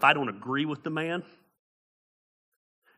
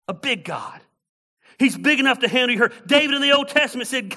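raised, loud, challenging rhetorical questions; impassioned preaching; 8 semitones higher, 8 dB louder than usual. Real, not acted.

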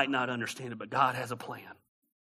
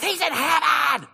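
high, stretched-out mock preacher holler; screaming preacher impression; 13 semitones higher, 7 dB louder than usual. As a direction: scream it slowly like an overwrought preacher. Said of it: "He's screaming".

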